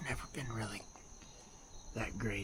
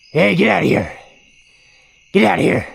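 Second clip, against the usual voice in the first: loud yell; scaring off a bear; 8 semitones higher, 23 dB louder than usual. Real, not acted. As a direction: shout the bear away.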